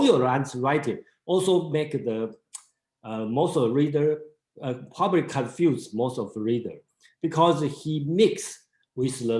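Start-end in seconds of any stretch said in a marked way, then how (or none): none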